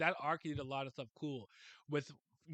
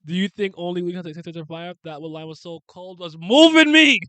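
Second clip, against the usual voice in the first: getting excited; 3 semitones higher, 16 dB louder than usual. Real, not acted. Fan cutting in on co-hosts about a moving line, getting excited. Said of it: "I'm getting excited".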